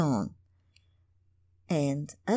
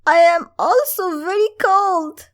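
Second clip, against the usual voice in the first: much higher, louder, fast plaintive crone; a shivering old woman complaining; 12 semitones higher, 8 dB louder, faster than usual. Acted.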